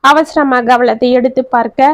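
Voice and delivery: usual speaking voice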